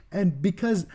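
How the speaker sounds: normal talking voice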